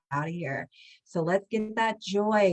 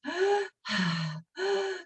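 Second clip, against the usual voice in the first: breathy demo sigh; 11 semitones higher than usual. Shown as a demonstration. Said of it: "we're just".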